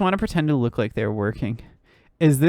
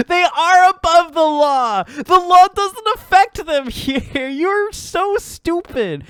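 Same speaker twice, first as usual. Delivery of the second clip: high squeaky laughing; 13 semitones higher, 5 dB louder, slower than usual. Real, not acted.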